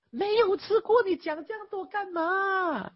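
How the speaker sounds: high, sharp taunting question; mock the talker who never tasted it; scornful retort. Taunting the one who never ate it. (高声嘲讽地质问)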